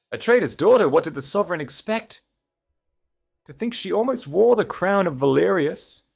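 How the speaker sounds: shrill high whisper of court gossip; high gossiping noblewoman. A narrator's reading. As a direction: sneer it like a catty court lady.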